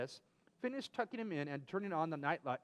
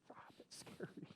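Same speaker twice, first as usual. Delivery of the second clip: whisper; 4 semitones higher, 13 dB quieter, slower than usual. Pretend. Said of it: "mock fright".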